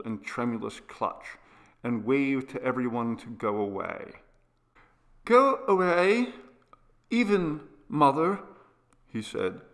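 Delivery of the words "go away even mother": put-on high, insistent young invalid; pleading child, pitched well up; agitated sick boy. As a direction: send them off in a high, urgent boy's voice. Sick boy sending everyone away.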